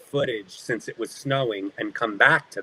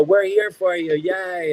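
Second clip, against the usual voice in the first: retold crew cheer, high and lifted; 8 semitones higher, 3 dB louder than usual. Crew to actor under pressure, cheering support. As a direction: imitate a supportive crowd's cheer, pitched up.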